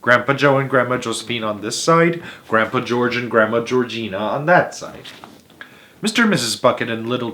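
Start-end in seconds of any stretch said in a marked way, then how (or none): none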